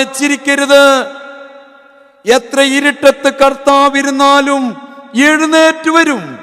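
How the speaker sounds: high, loud revival preaching; fervent preaching. Acted. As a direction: preach it out to the whole church. Preacher rises to full force.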